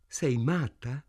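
plain reading voice

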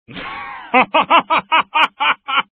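loud, high-pitched evil cackle; satanic laughter. A narrator's reading. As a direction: burst into a long fiendish laugh. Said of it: "proruppe in un satanico scroscio di risa".

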